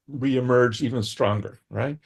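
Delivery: usual speaking voice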